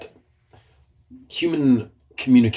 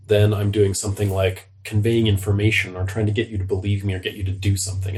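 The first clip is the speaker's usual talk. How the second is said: usual speaking voice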